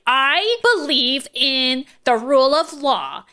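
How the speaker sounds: slow, exaggerated declaration; drawn-out, hammed-up earnestness; mock-solemn kid. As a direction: imitate a kid making a grand pronouncement. Mocking the kid's speech.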